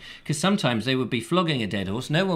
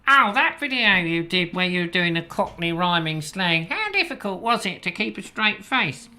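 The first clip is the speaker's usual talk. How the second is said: lightly put-on higher voice for a caller; imagined gushing caller; 5 semitones higher than usual. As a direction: act out an inquiring viewer, voice lifted. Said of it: "people saying".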